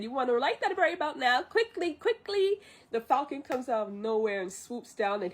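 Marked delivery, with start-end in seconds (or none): 0.0-2.6 rising to a high, urgent squeal; pitched-up frantic sidekick urging haste; imitated Mushu urging. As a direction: nag in mock panic, climbing higher. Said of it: "and Mushu said".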